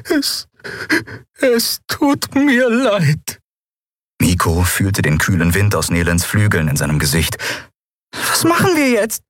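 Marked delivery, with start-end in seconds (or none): 0.0-3.4 very high, very slow falling apology; trembling slow apology. A narrator's reading. Xea frightened apology. 8.1-9.2 very high squeak; squeaky voice. A narrator's reading. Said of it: "piepste sie".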